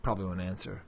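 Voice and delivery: usual speaking voice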